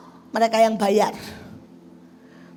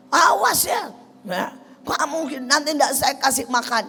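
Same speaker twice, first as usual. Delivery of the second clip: higher, louder mock threat; angry threat; 5 semitones higher, 6 dB louder than usual. Husband threatening his wife, shouted. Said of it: "dia bilang".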